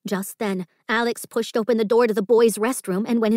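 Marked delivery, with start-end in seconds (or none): none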